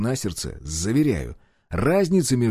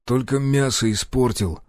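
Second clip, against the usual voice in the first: plain reading voice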